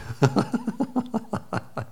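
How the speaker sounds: a short airy laugh under the breath; quick breathy laugh. Real, not acted. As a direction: chuckle at your own joke, almost silent. Laughs at his own circus joke.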